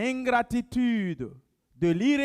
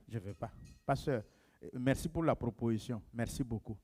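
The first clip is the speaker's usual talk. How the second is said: soft, much softer and lower, gracious decline; quiet polite refusal; 11 semitones lower, 13 dB quieter than usual. Shown as a demonstration. Pastor modelling a gentle grateful no to the church, hushed.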